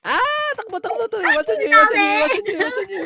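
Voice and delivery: high, loud panicked yelling; panicked shouting. Real, not acted. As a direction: yell in alarm, voice cracking. Team fleeing in game, alarmed.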